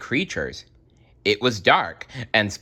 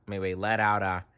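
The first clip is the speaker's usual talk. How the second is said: plain reading voice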